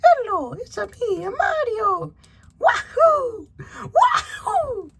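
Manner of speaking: very high, loud cartoon plumber; Mario. Toy reviewer voices the figure's catchphrase, high exuberant Mario voice. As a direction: squeal it high, whooping on each wahoo. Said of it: "he can say".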